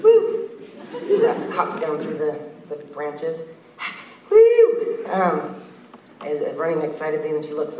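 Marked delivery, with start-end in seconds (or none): 0.0-0.5 high loud woo; joyful whoop. Acted. Girl hopping down branches, joyful whoop. 4.3-5.1 high loud woo; joyful whoop. Acted. Girl hopping down branches, joyful whoop. Running excitedly.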